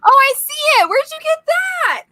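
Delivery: high, loud, excited; excited squeal. Real, not acted. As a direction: squeal with delight. Excited at seeing the cooler.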